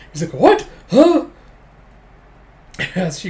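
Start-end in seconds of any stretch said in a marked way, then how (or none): 0.3-1.3 high, loud startled yelps; mock-stunned squawk, pitch shooting up; comic shock. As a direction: blurt it out in hammed-up disbelief. Rival stunned by the news, acted.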